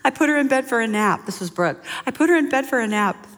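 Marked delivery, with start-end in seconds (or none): 0.0-1.3 higher, mock-fretful plea; acting out a worried young mother; distressed new mom. New mother calls her mentor for help, mock distress. Retold panicked call to mentor. 2.1-3.3 high, hammed-up fretting; mock-distressed mom. New mother reports the crying baby, mock distress. Retold panicked call to mentor.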